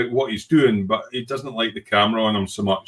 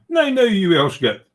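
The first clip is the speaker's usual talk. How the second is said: teasing quote; 9 semitones higher than usual. Retold or imitated. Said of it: "And John's going".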